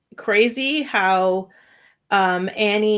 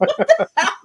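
high, squeaky what-the-hell; a pitched-up gasp of disbelief; shocked disbelief. Retold or imitated. Reacting to the flirty wife, high shocked disbelief.

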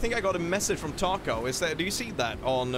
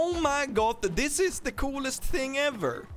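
high, mock-Swedish gushing; put-on Swedish accent; 8 semitones higher than usual. Gamer marvelling at the coolest thing, in a put-on Swedish accent. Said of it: "You know that voice that you put on".

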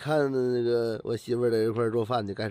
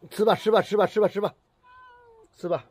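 coaxing a pet; 8 semitones higher than usual. Real, not acted. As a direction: urge the cat to eat, sing-song. Coaxing the cat to eat.

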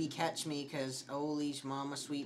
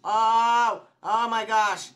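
loud, higher-pitched startled cry; startled yell; 6 semitones higher, 11 dB louder, slower than usual. Real, not acted. Player jumps at a game scare, startled. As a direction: yelp as the scare hits.

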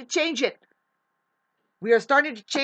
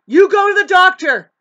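loud outburst; 6 semitones higher, 10 dB louder than usual. Real, not acted. Heated rant at narcissists.

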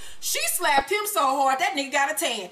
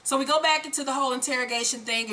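ordinary talk

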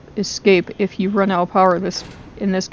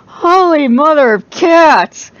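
excited exclamation; 7 semitones higher, 5 dB louder, slower than usual. Real, not acted. Exclaiming after close call.

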